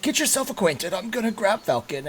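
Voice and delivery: high-pitched host voice; high, helpful character voice; Corwin character voice. Acted. Corwin leaves his guest to settle in, high-pitched host voice. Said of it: "he's like".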